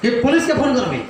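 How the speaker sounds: scolding shout. Pretend. Homeowner yelling at a thief to get out, mock scold. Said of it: "चिल्ला के उसको डांट के बोलेंगे".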